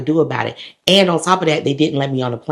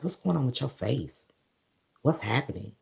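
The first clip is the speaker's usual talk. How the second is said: hushed questioning; 12 dB quieter than usual. Retold or imitated. Officer questions her, acted out.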